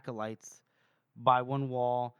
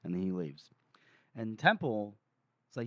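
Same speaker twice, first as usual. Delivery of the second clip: usual speaking voice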